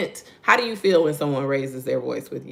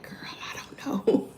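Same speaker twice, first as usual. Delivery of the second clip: high, whispery mock confusion; clueless hushed reply; 11 semitones higher, 6 dB quieter than usual. Mother answers daughter's question about sarcasm, clueless whisper. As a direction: whisper back, imitating someone just as lost.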